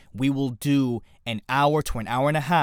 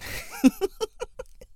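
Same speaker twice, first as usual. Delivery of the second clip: high, wheezy laugh; high giggle. Real, not acted. Host amused by his own bad accent, high giggling. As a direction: crack up at your own impression.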